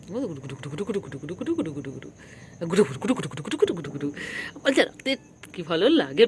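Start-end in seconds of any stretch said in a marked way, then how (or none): 0.1-4.1 rapid-fire nonsense babble; tickling patter. Real, not acted. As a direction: rattle it off while tickling the pet. Tickling the pet with nonsense words. (দ্রুত কাতুকুতুর বুলি)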